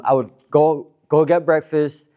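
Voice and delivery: ordinary delivery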